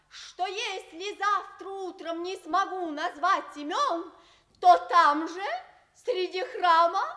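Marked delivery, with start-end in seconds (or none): none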